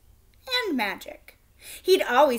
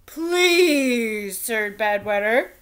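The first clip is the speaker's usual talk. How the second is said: louder pleading king; king begging; 6 dB louder, much slower than usual. A narrator's reading. King pleading with the knight to stay. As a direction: beg him not to leave. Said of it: "the king begged".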